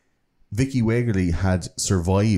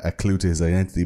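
ordinary delivery

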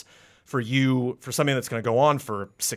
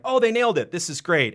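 delighted; 6 semitones higher, 3 dB louder than usual. Retold or imitated. Retold delight at game.

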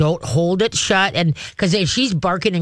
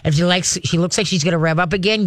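usual speaking voice

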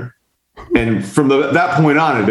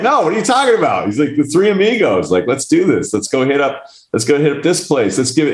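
excited friend; 7 semitones higher than usual. Retold or imitated. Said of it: "And my friend's like".

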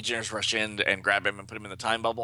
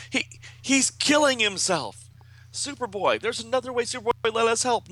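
high, distraught comic-book dialogue; hammed-up high cries of alarm; pleading friend; 13 semitones higher, 3 dB louder than usual. A narrator's reading. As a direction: beg urgently in a raised voice. Acts friends pleading with Superboy.

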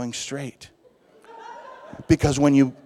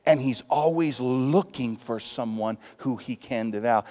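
normal talking voice

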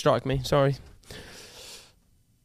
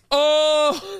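surprised; 9 semitones higher, 3 dB louder than usual. Real, not acted. Spit lands on the mic.

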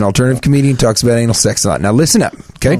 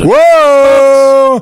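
excited cheer; 12 semitones higher, 6 dB louder than usual. Acted. Mock basketball shot.

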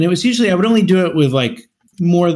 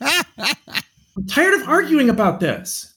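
high, drawn-out fed-up complaint; mock argument; 9 semitones higher, much slower than usual. Shown as a demonstration. Husband ending his staged argument with his wife, mock exasperation. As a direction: drag it out, pretending to be sick of arguing. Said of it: "in the middle of an argument".

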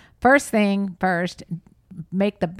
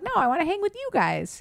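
high-pitched, nasally little-boy impression; baby Cam voice; 10 semitones higher than usual. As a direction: imitate a cute kid in a high voice. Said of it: "the voice got very high pitched and, nasally".

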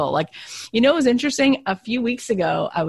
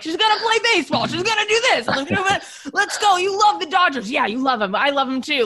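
much higher, fast, pumped-up coach-dad imitation; overeager sports dad; 10 semitones higher than usual. Wife mocking a pushy dad who turns girls into ballplayers, hammed-up high and fast. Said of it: "They're like".

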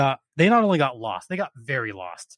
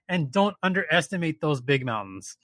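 ordinary talk, nothing marked

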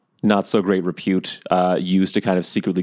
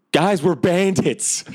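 high, drawn-out call to fellow bandits; comic slow announcement in a raised pitch; demonstrated phrase; 10 semitones higher, much slower than usual. Shown as a demonstration. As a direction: sing out the phrase slowly and high. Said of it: "just say basically".

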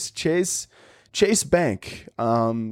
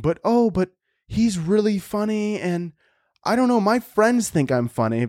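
mocking a fan; 8 semitones higher than usual. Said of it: "you're thinking".

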